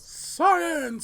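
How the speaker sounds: big dramatic announcer call. Pretend. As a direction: shout it like a show host. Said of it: "you have to say it like a forty space spell announcer".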